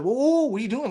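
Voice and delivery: high, startled mock protest; retold alarm. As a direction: act out stopping a child in alarm. Retold alarm at daughter pushing TV.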